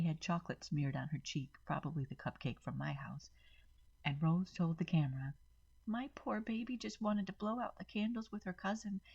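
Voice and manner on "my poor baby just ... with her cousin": fake crying. A narrator's reading. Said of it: "Rose was fake crying".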